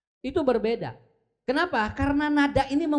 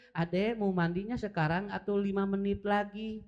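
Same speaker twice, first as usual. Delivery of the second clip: softer, patient offer of a choice; a soft, patient mother giving two options; gentle mother; 3 semitones lower, 6 dB quieter than usual. Retold or imitated. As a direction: ask the child kindly, sitting level with them. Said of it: "Ibunya duduknya begini".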